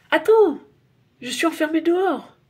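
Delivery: urgent call up to the window; cry out. A narrator's reading. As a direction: call out before she closes the window. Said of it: "s'écrie Nicolas".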